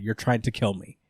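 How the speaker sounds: flat, soft, matter-of-fact; plain and quiet. Shown as a demonstration. Daredevil calmly tells the warden, flat and soft. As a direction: say it plainly, no drama. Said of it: "Like, regular, plain, just like that".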